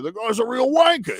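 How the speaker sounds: high, rising put-on British accent. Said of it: "had to do my British accent".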